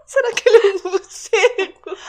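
high, loud giggling question; laughing. Real, not acted. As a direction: ask it while laughing. Joking tattoo became a bat.

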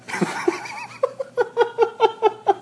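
high burst of laughter; high giggling laugh. Real, not acted. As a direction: crack up at the joke. Laughing at dick joke.

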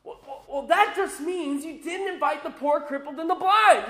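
stammering start building to a high, loud finish; mock outrage, rising on the accusation; angry retort. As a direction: act out an indignant master, mildly hammed-up. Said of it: "Gets angry. He goes".

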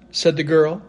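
ordinary delivery, nothing marked

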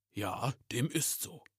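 slow, measured Santa voice; a lightly voiced, unhurried Santa; solemn Santa Claus. Santa answering the frightened elf, solemn and slow.

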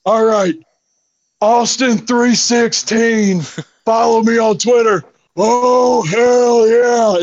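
hammed-up Stone Cold hell yeah; Steve Austin impression. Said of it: "I did my Steve Austin impression".

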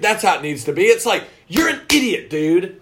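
normal talking voice

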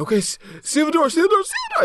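high, frantic repeated order; a mock-panicked security officer sealing the door. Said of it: "He's like".

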